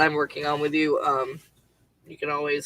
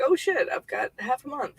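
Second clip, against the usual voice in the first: high, softened retold panic; mock alarm; 8 semitones higher, 4 dB quieter than usual. Job seeker discovering savings nearly gone, mock alarm. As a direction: reenact a sudden worried realization, high and light.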